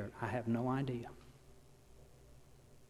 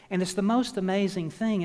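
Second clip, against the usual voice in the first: higher, louder burst of wonder; amazed; 6 semitones higher, 6 dB louder than usual. Real, not acted. Preacher marvelling to the congregation, excited. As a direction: marvel out loud. Awed exclamation.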